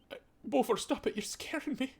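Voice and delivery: high, shaken plea; frightened. Acted. Mother begging her son to stop scaring her. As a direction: back away from him in fear. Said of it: "and she just goes".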